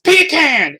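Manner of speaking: sudden high yell of "Pecan!". Retold or imitated. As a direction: shout "Pecan!" way up high, pee-can. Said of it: "Bill just yells".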